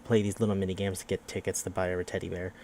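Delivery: ordinary talk, nothing marked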